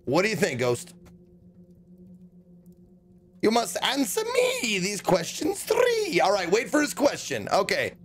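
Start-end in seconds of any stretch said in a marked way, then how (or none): 3.4-6.2 mock bridgekeeper. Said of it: "quoting the riddle-keeper line".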